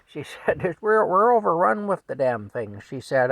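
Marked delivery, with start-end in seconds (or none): 0.8-2.8 loud, high cussing complaint; blunt wife raising her voice; yelling wife. Retold or imitated. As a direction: holler it in exasperation. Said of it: "she yells at Frank".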